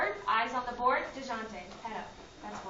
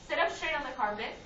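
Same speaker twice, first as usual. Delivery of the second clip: ordinary delivery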